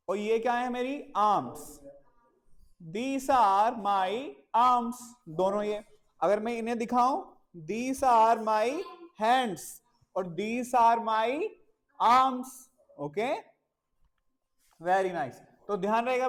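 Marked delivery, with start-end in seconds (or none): 3.0-12.5 very slow and louder model sentence; teacher slowly modelling these are my arms. Shown as a demonstration. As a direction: stretch each word out for the children. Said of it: "teacher showing body parts".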